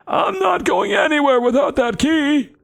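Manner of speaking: put-on high, stubborn old goblin; old guy voice. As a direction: dig in as an obstinate old-timer. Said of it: "I'm gonna give him the old guy voice".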